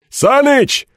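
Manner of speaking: calling out. Acted. Men calling out to Sanych.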